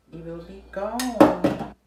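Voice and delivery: loud, slow, theatrical wail; mock dramatic lament. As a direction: ham up the loss, drawn out.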